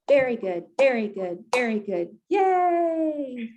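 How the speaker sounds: very good chant ending in a high yay; cheer. Shown as a demonstration. Leader teaches the group's ending cheer, high yay. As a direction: chant then squeal yay. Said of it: "we're gonna say".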